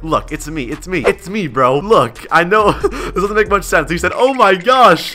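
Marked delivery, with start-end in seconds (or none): 4.1-5.1 loud, high, drawn-out awe; amazed fan. Acted. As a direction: read it as a starstruck fan, slow and high. Reads fan's shocked chat reply.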